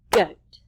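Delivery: GOAT as one loud, punched syllable; single clapped syllable. Shown as a demonstration. Teacher clapping out a word for children, GOAT as one loud beat. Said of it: "clap one time".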